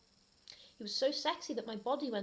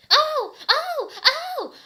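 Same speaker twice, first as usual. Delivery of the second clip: shrill, hammed-up ecstatic Oh cries, very high and loud; mock screaming; 15 dB louder, much slower than usual. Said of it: "I screamed".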